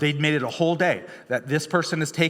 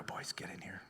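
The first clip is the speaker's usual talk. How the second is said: whisper; 5 semitones lower, 20 dB quieter than usual. Retold or imitated. Father calling boys in.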